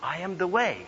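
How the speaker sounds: feed the line quietly to someone on stage; mock whispered cue; imitated whisper. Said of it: "so he whispered quietly".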